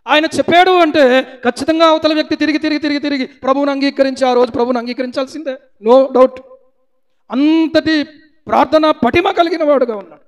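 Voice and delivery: loud, high insistent preaching; forceful preacher's climax; fiery preaching. Acted. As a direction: hammer it home from the pulpit. Preaching rises to full force.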